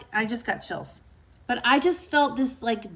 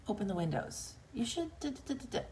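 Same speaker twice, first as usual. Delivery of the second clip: very soft, trailing into da-da-da; inner voice; 2 semitones higher, 11 dB quieter, faster than usual. Acted. Host voicing the whispers she follows, hushed little voice. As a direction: let the little suggestion fade away quietly. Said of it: "I'm gonna hear a voice say".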